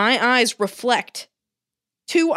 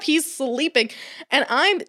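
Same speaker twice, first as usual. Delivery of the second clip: ordinary delivery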